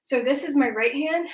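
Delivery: normal talking voice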